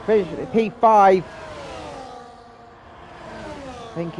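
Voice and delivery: brisk live play-by-play call; race commentary. Real, not acted. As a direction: call the qualifying laps as they happen.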